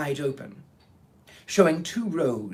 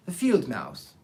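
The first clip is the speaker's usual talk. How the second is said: usual speaking voice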